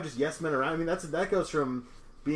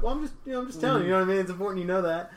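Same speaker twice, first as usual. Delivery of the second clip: gentle insisting; 6 semitones higher, faster than usual. Retold or imitated. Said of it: "I'm like".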